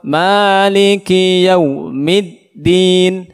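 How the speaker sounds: slow, measured chant of "Maliki yaumiddin"; unhurried recitation. Shown as a demonstration. Teacher warns pupils not to rush, slow recitation of "Maliki yaumiddin". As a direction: take "Maliki yaumiddin" without rushing, vowels held. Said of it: "jangan cepat-cepat".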